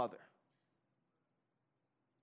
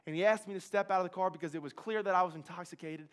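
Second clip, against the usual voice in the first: ordinary delivery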